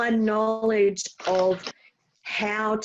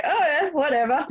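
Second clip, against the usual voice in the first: bored shrug; 5 semitones higher, faster than usual. Retold or imitated. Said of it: "people are looking like".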